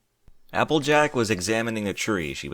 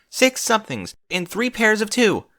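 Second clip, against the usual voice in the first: frantic; 11 semitones higher, 4 dB louder than usual. A narrator's reading. Frantic insisting.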